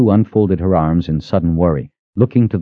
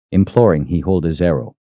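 plain reading voice

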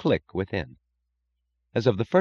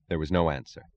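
ordinary delivery

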